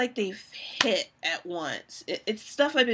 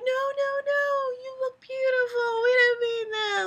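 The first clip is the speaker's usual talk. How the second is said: backpedaling friend. Retold or imitated. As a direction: squeal a frantic apology. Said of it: "they backtrack, like".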